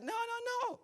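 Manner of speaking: very high, soft sing-song no, no, no; playful Jesus. Retold or imitated. Preacher voicing Jesus teasing disciples, very high and soft. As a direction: tease them gently. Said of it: "And it's like".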